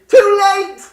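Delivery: high, loud gotcha cry; spring the trap with a yell; pouncing shout. Acted. Reaper pounces on hider.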